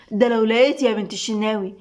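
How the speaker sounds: ordinary delivery, nothing marked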